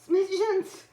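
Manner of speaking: sharp high call to the cat across the room. Real, not acted. Vlogger calls out to her cat Smidgens, high and loud.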